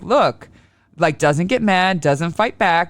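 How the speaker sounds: ordinary talk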